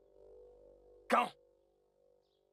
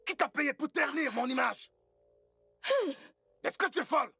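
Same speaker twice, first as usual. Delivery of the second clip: raised, higher-pitched angry outburst; indignant shouting; 4 semitones higher, 4 dB louder than usual. Acted. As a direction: shout in outrage at the accusation. (cri de colère indigné)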